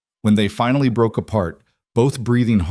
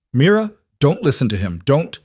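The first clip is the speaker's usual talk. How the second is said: higher and a bit louder, urgent warning; strained, furious; 6 semitones higher, 3 dB louder than usual. Acted. Captive Dante warning Mira over the phone, strained and furious. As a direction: force it out through the strain. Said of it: "strained but furious".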